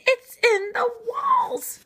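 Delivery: high, loud, breathy screech. Acted. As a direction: shriek it in alarm. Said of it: "Owl screeched".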